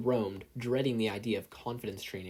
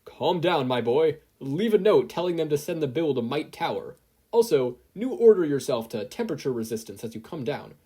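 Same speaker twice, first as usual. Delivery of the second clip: louder reassuring hero; loud calm mentor; 4 semitones higher, 6 dB louder than usual. Acted. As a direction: take charge and steady him. Mentor steadies panicked boy.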